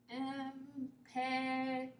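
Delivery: em- and pe- murmured softly to herself; quiet spelling mutter. Real, not acted. Teacher murmurs the syllables softly while writing. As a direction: trail off softly while working it out. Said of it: "I'm spelling everything wrong".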